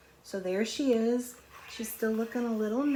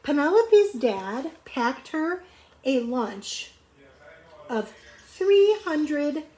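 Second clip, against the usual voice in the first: slow, higher build to the number; dramatic reveal; 5 semitones higher, 5 dB louder, slower than usual. A narrator's reading. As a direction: stretch it out for the big reveal. Read-aloud dramatic build.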